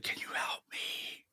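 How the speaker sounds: breathy ghostly whisper. Retold or imitated. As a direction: whisper it like a faint spirit voice. Retelling a ghostly voice.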